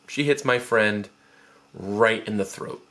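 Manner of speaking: normal talking voice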